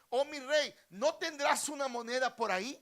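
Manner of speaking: high, loud acted plea; loud begging. Beggar asking the king for a coin, loudly pleading. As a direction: beg across a crowd at full voice.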